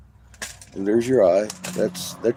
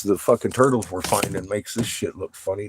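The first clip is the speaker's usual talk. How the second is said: ordinary talk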